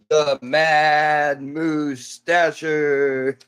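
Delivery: hammed-up showman introducing a star; announcer voice. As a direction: drag out "Mad Moustacher" like a wrestling announcer. Announcing son's silly character name.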